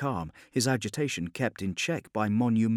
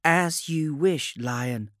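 high, slow puppet voice, louder at the end; eerie puppet servitor; 8 semitones higher, 7 dB louder, slower than usual. A narrator's reading. Puppet bowing to the Lion's wish, eerie. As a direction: stretch the words at raised pitch.

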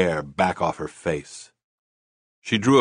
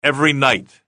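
sudden higher, louder blurt; 8 semitones higher, 6 dB louder than usual. A narrator's reading. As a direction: let it burst out. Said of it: "he blurted before he could help himself".

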